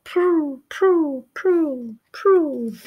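high falling pigeon coos. Acted. Pigeons flying down to meet them, high falling coos. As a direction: coo each one downward like a pigeon. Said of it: "The pigeons go".

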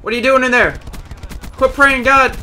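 loud, high holler at an opponent; mock-scolding yell. Real, not acted. Yelling at opponents in the game.

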